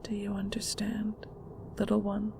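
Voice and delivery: whispered, quiet; a mother ending the lesson in a whisper; soft and controlling. Acted. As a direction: close it gently but firmly. Mother closing the lesson softly.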